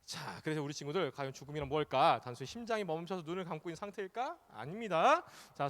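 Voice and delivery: usual speaking voice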